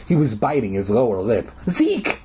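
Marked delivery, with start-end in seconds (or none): 1.7-2.2 high, alarmed cry. A narrator's reading. Girl spots her friend behind her, crying out. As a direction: cry out his name, pitched very high. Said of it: "I cried".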